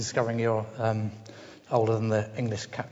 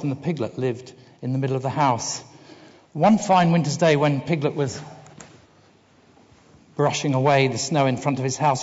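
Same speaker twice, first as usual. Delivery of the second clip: lively, louder storybook narration; story reading; 2 semitones higher, 4 dB louder than usual. Acted. Speaker reading a children's book to the audience, lively storyteller voice. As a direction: read it out like a bedtime story. Reads Winnie the Pooh aloud.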